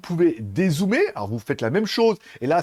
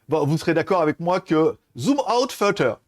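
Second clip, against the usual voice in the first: normal talking voice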